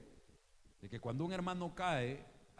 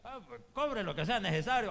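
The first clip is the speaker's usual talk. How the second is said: mock eager patient; 7 semitones higher, 7 dB louder than usual. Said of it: "Usted llega".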